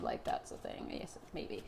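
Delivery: whispered self-mocking aside. Real, not acted. Reader teasing her own word choice in a whisper. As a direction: breathe it to yourself under the breath.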